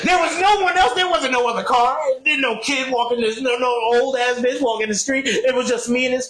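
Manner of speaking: ranting at the empty street; angry outburst. Retold or imitated. Venting to friends about the crash, heated. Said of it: "Just angry".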